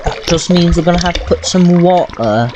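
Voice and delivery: half-hearted British accent while playing. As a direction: chat along in a mock British accent. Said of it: "Gonna do the rest of this with a British accent".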